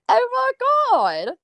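mock gasp. Mocking reaction to a Reddit comment.

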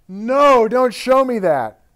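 loud, high, drawn-out refusal; acted alarmed yell. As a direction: shout it out as the panicking client. Acting out client's sudden violent reaction.

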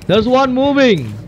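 startled player yelping as a monster starts moving; panicked yelps. Real, not acted. Gamer spotting a moving monster yelps in real panic.

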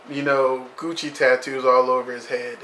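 ordinary talk

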